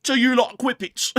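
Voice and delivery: high, loud mock-British question; cheeky high-pitched British marmot. Marmot offering whippets to onlookers, high cheeky British accent. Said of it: "all of our marmots are British".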